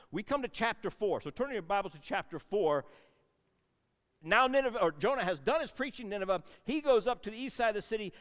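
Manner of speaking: loud, driving sermon delivery; rising preacher's fervour; full-force preaching. Real, not acted. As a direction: preach it out to the room.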